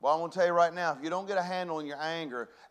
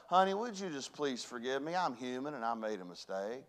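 soft, low, forgiving reply; calm him with a quiet apology; gentle pleading wife; 4 semitones lower, 7 dB quieter than usual. Retold or imitated. Wife soothing her angry husband, soft and low.

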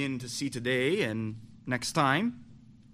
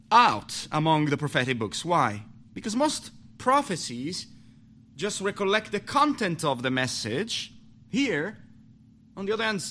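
preaching; 4 semitones higher than usual. Acted. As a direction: preach it out with fire. Preaching at full force.

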